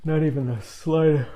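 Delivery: real yawn swelling loud and high through the words. Real, not acted. Tea drinker caught off guard mid-tasting, yawning. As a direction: talk right through a yawn. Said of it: "I don't know why I'm yawning".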